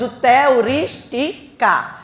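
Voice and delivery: "teurística" very slow, in separate syllables. Shown as a demonstration. Teacher dividing the word for students, syllable by syllable. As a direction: space out each syllable of "teurística". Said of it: "porque a gente separa".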